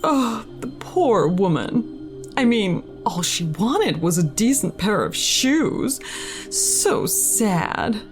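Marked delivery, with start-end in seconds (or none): none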